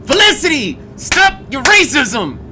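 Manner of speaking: high, loud mock scold; shrill pretend reprimand. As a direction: playfully scold someone for racism.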